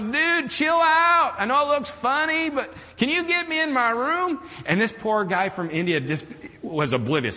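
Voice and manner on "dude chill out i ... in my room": high-pitched Arkansas twang; Paul. Retold or imitated. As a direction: plead in an exaggerated high Arkansas drawl. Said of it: "he's got this twang".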